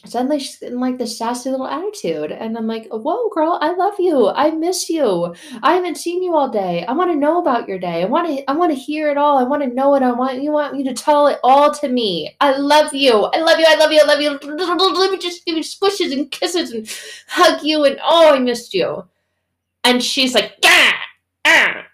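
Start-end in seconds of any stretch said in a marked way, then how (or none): 2.9-19.0 high, loud, hammed-up outpouring of affection; gushing mom. Gushing mom to her sassy daughter. 20.6-21.8 loud, high mock growls of a rude kid; sassy snarl. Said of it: "Sassy, attitude, rude, mean".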